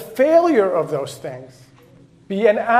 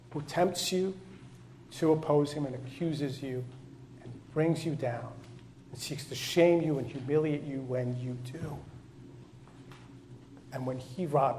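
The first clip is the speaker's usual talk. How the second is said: quiet preaching; 3 semitones lower, 8 dB quieter than usual. Acted. Quiet intimate sermon passage.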